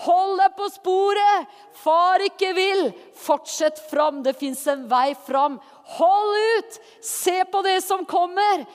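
loud, high, drawn-out urging cries; rousing exhortation. Acted. The Holy Spirit urging believers to keep going, rousing shout. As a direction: cheer them on with full force.

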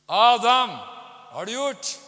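loud, high, booming call; God calling. Retold or imitated. God calling Adam in the garden, stern shout. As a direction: call out sternly, stretching the words.

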